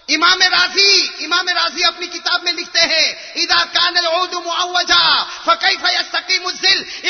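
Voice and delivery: loud, ringing sermon voice with drawn-out Arabic; fiery preaching. Acted. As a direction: preach it to the whole mosque. Quoting Imam Razi from pulpit. (پرجوش خطبہ، بلند آواز میں)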